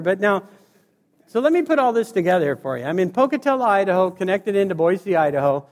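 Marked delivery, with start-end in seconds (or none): none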